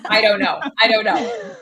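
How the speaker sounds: laughing about the juicy pre-chat gossip. Real, not acted. Guest laughing while dodging the host, laughing.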